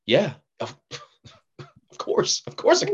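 hesitant start, then high-pitched assurance; mock eager agreement. Actor retells bluffing a producer about an accent, mock eager. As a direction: bluff a yes, pitch jumping up at the end.

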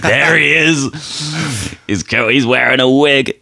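slow, high pointing-out voice; ducks spotting him. Pretend. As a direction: call it out like a lookout. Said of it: "they're like".

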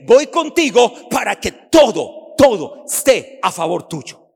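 loud, rising preaching; full-force preaching. Acted. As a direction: build it to a roar for the church. (predicación a todo pulmón)

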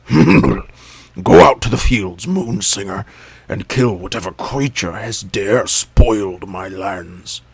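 loud, slow commanding elephant voice; angry king. A narrator's reading. Elephant King ordering Jackal to kill, slow and angry. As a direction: order the kill with royal fury. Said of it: "was angry at being disturbed".

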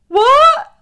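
very high, loud squawk; exaggerated taken-aback reaction; baffled shock. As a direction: imitate a friend's startled disbelief. Friend baffled by abrupt goodnight.

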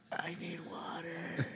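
slow, parched whisper. Retold or imitated. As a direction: rasp it out like you're dying of thirst. Retold hungover plea.

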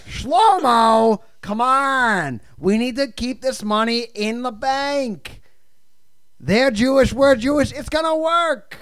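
hammed-up panic about the money.

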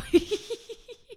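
a loud, high burst of laughter; real delighted laugh. Real, not acted. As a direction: crack up at your own punchline. Laughing at her own punchline.

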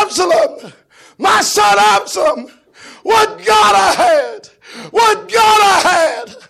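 high, loud, slow lament with a cry; grieving wail. Acted. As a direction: wail for the lost son. King wailing for dead son.

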